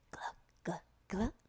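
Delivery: hushed, whispered syllables; secretive little whisper for a pupil to copy; whispered sound drill. Shown as a demonstration. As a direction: whisper each sound separately. Demo of varied sound drill.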